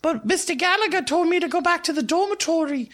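high-pitched schoolgirl, rattling on; Harriet. Acted. Harriet repeats what Mister Gallagher told her, high-pitched and chatty. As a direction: recount it in a high, girlish voice. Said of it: "Harriet said".

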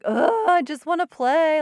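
loud, high whiny child imitation; mock crying. Son in bed protesting to mom, mock crying whine. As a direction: wail it like an upset kid, exaggerated. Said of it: "he's still crying".

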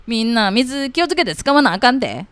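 second try at the bossy Kansai uncle. Acted. Kansai uncle repeating water-saving warning, firm Kansai dialect. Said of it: "おじさん関西弁".

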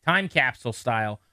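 usual speaking voice

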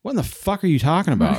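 put-on fast, high outraged old lady; baffled grandma. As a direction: blurt it out like a stunned grandma. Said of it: "I'm gonna take over from there".

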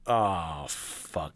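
low, sinking near-whisper; hushed dismay. Retold or imitated. Accused of cheating by waitress, low hushed dismay. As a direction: let it drop away under the breath. Retold dread after being accused.

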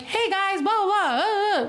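high, strained pushing from the throat; pushed voice. Shown as a demonstration. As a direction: push the voice up high. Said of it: "I'm always pushing with my vocals".

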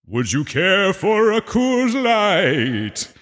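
slow, high, put-on waiter voice; mock bartender. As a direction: play a slow, overly polite bartender. Pretend bartender at haunted tavern.

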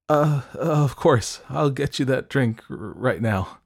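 put-on flustered, over-eager girl; nervous stammer. A narrator's reading. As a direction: stumble eagerly, settling by the end.

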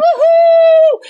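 loud high whoop; joyful woohoo; excited whoop. Real, not acted. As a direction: cheer it out loud. Cheering reply.

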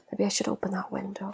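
whispered aside; hushed thought about the window. Real, not acted. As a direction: say it to yourself, barely voiced. Muttered aside about stuffy room.

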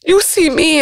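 choked-up delighted gasp of wonder; tearful joy. Retold or imitated. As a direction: relive the moment of being seen, near tears. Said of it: "I just be so excited and joy".

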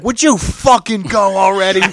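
hollering at the stalled driver ahead; retold yell. Guy behind him yelling out the car, a mock road-rage holler. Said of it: "I hear him yelling out the fucking car".

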